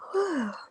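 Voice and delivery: high drawn-out yawn. Real, not acted. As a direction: let a big yawn out. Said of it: "Sorry, I keep yawning".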